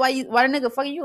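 ordinary delivery

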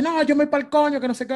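high-pitched mock tantrum; imitated yelling woman. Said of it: "que ella me está gritando". (imitación aguda de mujer gritona)

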